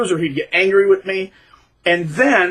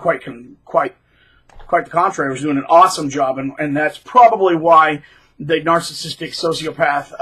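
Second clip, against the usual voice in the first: ordinary talk, nothing marked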